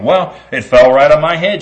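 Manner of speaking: high, loud indignant hen; insistent hen. Acted. Henny Penny answers Goosey Brucey, high and loud. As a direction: insist loudly it hit her head.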